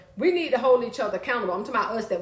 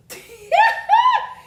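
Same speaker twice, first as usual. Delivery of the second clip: big burst of real laughter; loud high laugh at her own joke; 8 dB louder than usual. Real, not acted. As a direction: cackle out loud at yourself.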